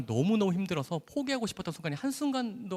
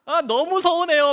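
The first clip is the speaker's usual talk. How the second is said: high, loud, slow hurt whine; mock sulky complaint; 13 semitones higher, 10 dB louder, slower than usual. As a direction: play the offended patient. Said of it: "막 이렇게 화를 막 내요". (번호 저장 안 했다고 서운한 환자가 높고 크게 투정하듯)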